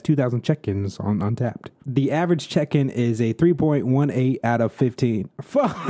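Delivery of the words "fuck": high cracking laugh at himself; laughing burst. Real, not acted. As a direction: laugh off the blunder. Burst of laughter at his mistake.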